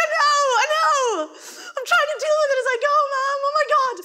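high, loud and fast panicked gabble; frantic apology; frantic teen. Retold or imitated. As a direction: babble while dressing in a rush. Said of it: "And I'm like".

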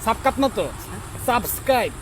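ordinary talk

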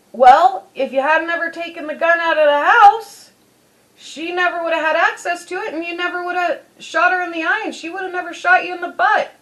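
lecturing dad. Retold or imitated. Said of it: "the dad's like".

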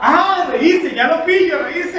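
high, loud, giddy realisation; manic excitement. Acted. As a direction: burst out in wild glee.

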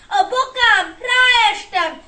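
loud, high wail of hurt; hurt child's distressed yell; pained cry. A narrator's reading. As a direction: cry out over an injured ankle. Boy hurt his ankle.

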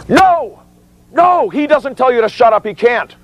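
very high, strained cry; emphatic refusal. Shown as a demonstration. Instructor telling witnesses not to shut up, crying out. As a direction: cry out in high-pitched protest.

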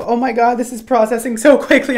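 high, loud panicky excitement; freaking out. Real, not acted. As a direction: rush it out in alarm. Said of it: "I'm freaking out".

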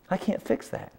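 barely voiced, hopeless admission; almost no voice, defeated; hushed despair; whispered despair. Real, not acted.

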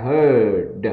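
"heard" with a long er vowel; drawn-out HEARD. Shown as a demonstration. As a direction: stretch the vowel in "heard", end on D. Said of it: "âm này kéo dài". ("heard" kéo dài âm ơ)

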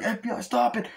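high, strained police command; hammed-up FBI agent yelling; mock cop shout. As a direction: bark it like a cop storming in. Cop barging into the shootout.

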